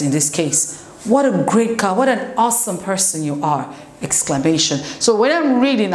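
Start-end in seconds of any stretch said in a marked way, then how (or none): none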